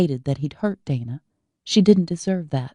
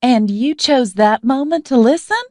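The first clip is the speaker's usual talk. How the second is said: much higher and louder shrieked question; 8 semitones higher, 7 dB louder than usual. A narrator's reading. Izzy shrieking at her brother in disbelief. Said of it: "Izzy's voice was a shriek".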